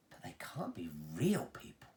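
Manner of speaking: hushed, breathless whisper. Acted. Charlie whispers to Wonka in disbelief, hushed. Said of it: "Charlie said".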